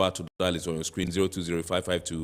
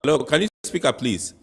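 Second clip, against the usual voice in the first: raised voice to a bad line; to someone hard of hearing; 8 semitones higher, 7 dB louder than usual. Real, not acted. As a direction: call out loudly down the phone. Host calling to faint caller.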